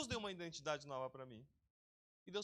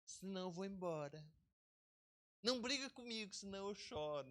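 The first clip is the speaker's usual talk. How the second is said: touchy whiner. Retold or imitated. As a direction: play the easily offended, close to tears. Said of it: "o ego fica assim".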